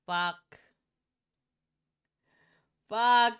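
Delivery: loud cursing; blurting out in frustration; frustrated shout. Real, not acted. Reacting to twist.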